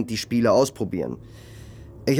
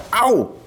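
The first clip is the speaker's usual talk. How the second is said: sharp high ouch of pain; pain yelp; 12 semitones higher, 6 dB louder than usual. Acted. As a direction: yelp as if hit. Hit by friend.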